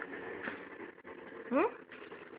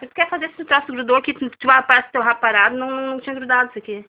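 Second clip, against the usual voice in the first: scolding; 9 dB louder than usual. Real, not acted. Mother scolding the fussing child.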